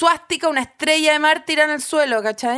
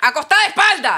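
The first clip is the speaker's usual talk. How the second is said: exasperated order; 3 semitones higher, 4 dB louder than usual. Retold or imitated. Quotes exasperated order to lie down.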